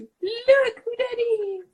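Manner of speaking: high, slow cooing at the cat. Real, not acted. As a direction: coo at a kitty. Greeting the cat.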